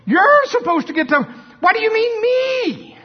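very high, loud, indignant protest; Norval imitation. Norval protests to the Lord, shrill high loud indignation. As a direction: squawk it up high in outraged disbelief. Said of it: "Am I doing it pretty good?".

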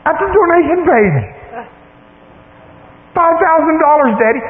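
very high-pitched squeal of excitement; excited daughter. Retold or imitated. Daughter squeals the gift amount to dad, excited. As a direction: imitate a thrilled daughter at the top of the range. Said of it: "She's all excited".

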